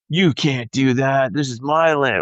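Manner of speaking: 높고 날카로운 항의조로, 흉내 내듯이; shrill indignant woman. Retold or imitated. Angry landowner's daughter retold.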